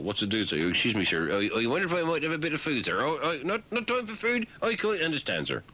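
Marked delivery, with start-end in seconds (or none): 0.7-3.0 high, fast, timid little fish begging; humble fish voice. Pretend. A humble zebrafish begs for food, high and fast. 3.0-5.6 high, fretting little fish voice; a bewildered fish, pitched high; confused fish voice. Pretend. The fish is puzzled, high fretting voice.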